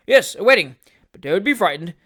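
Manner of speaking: hearty and consoling, a bit loud; hearty old gentleman. A narrator's reading. Wardle teasing Snodgrass.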